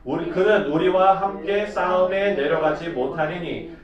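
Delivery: measured solemn Bible reading; formal verse recitation; scripture reading. Acted. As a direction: read it out to the congregation. Reads scripture aloud.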